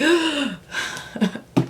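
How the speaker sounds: a sharp gasp of awe; gasp, lost for words; breathless gasp of disbelief; amazed gasp. Real, not acted. Overwhelmed by the Packers.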